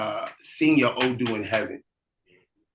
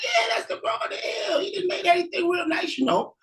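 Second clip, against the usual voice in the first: much higher, eager local voice; helpful townsfolk. Acted. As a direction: point the way, full of cheer. Locals answer.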